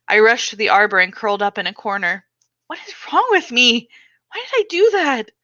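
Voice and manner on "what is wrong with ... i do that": high, anguished questions; distressed self-questioning. Acted. Girl curled in the arbor asking what is wrong with her. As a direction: ask yourself in horror.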